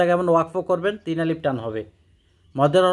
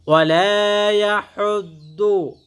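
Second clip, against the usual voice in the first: raised chanted recitation; Quran recitation; 4 semitones higher, 4 dB louder, much slower than usual. Shown as a demonstration. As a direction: recite it out melodically. Recites the verse. (উঁচু গলায় তিলাওয়াত)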